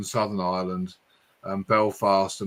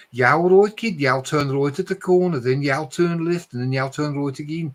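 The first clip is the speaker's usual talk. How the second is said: put-on higher, louder Birmingham accent; Brummie accent; 5 semitones higher, 4 dB louder than usual. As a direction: put on a cheery Brummie voice giving directions. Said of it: "they talk like this".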